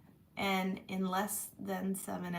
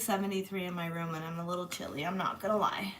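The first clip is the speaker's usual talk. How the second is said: usual speaking voice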